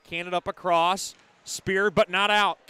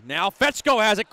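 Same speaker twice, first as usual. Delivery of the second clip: ordinary talk, nothing marked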